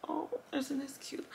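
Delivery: unvoiced whisper, pleased; delighted whisper. Real, not acted. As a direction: gush softly over something cute.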